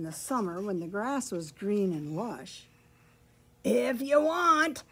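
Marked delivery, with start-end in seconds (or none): 3.6-4.8 high, loud, drawn-out goat voice; storybook goat calling out; crying-out goat. A narrator's reading. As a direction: cry it out slowly as a goat. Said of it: "he cried".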